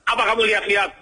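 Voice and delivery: high-pitched mock aggression; harsh rude challenge. Arrogant person confronting someone who looks at them, harsh and rude. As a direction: play someone spoiling for a fight. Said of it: "ngomongnya kasar, keras".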